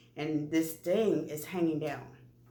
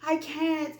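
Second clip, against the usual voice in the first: high, louder retold panic; freaking out; 8 semitones higher, 4 dB louder than usual. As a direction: plead in rising alarm. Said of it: "Now I'm starting to freak out".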